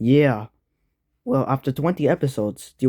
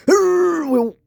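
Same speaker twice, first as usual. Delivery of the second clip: squeaky voice; 4 dB louder than usual. Acted. Odd high character voice.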